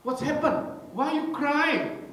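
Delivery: high, slow mother's asking; worried mother. Retold or imitated. Mother asking Cynthia why she is crying, high and slow. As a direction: ask the crying child gently but high.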